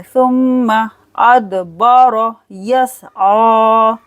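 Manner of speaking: सुम्मा with a long hummed m, bounced d in अदबर; slow सुम्मा अदबर यसआ, humming the m, final aa held; ghunna and qalqala. Shown as a demonstration. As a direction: hum on the m of सुम्मा, echo the d, stretch यसआ. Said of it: "मीम पर गुन्ना करना है".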